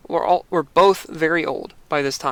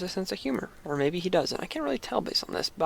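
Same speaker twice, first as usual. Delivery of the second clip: quiet aside; 3 semitones higher, 9 dB quieter than usual. Real, not acted.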